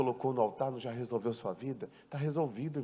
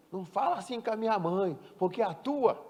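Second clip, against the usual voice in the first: higher, indignant spouse defending her mother; a hint of an offended wife; 6 semitones higher than usual. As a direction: snap back at the insult, lightly acted. Spouse voiced in quarrel.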